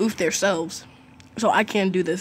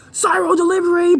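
shout; 10 semitones higher, 7 dB louder than usual. Real, not acted. Announcing game item.

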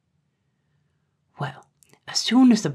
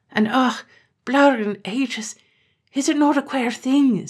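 mournful lament; 8 semitones higher, slower than usual. Acted. Terence lamenting his father's fate.

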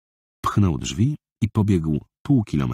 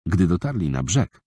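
usual speaking voice

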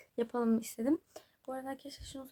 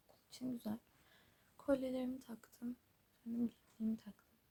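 whispering; 6 dB quieter than usual. Real, not acted. Quiet early morning prep talk.

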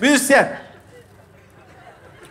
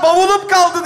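raised, higher coaching shout; shouting demo; 5 semitones higher than usual. Shown as a demonstration. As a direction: show how to yell it out. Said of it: "diye bağır".

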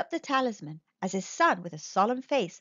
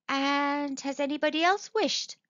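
high, slow, probing question; persistent old lady; 5 semitones higher than usual. A narrator's reading. Old lady pressing the soldier further, persistently. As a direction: press on in an old lady's raised voice. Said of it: "persisted the old lady".

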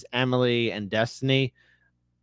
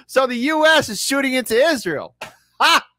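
amused disbelief; 10 semitones higher, 3 dB louder than usual. Real, not acted. Streamer mocking the debate.

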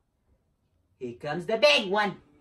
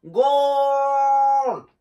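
long, high, loud "goal"; soccer goal cry; 6 semitones higher, 6 dB louder than usual. Pretend. Player running round the field like a soccer player, goal cry. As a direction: draw it out like a scoring celebration.

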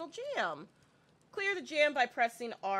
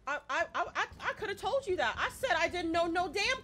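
high, stammering, loud protest; worked-up complaint in a raised voice; exasperated outburst; 8 semitones higher, 5 dB louder than usual. Real, not acted. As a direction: protest indignantly, tripping over the first words. Protesting about the unknown code.

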